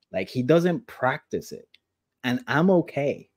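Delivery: usual speaking voice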